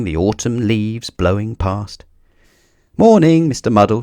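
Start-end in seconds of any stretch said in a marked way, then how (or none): 3.0-4.0 a louder, chirpy good morning; cheerful greeting. A narrator's reading. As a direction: call out a friendly hello. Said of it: "she said to Mister Muddle".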